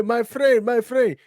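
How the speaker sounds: a hint of a street hawker's lure; hustler's call. Warning viewers about scammers, mild hustler voice. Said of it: "Anytime you hear".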